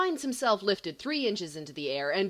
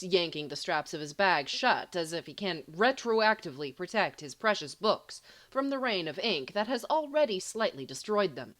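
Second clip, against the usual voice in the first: ordinary delivery, nothing marked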